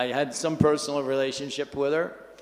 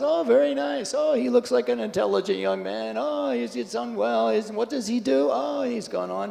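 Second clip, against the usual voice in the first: higher-pitched, gushing imitation of polite praise; admiring small talk; 8 semitones higher than usual. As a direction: lightly act out someone fussing over each relative. Acted admiring small talk with family.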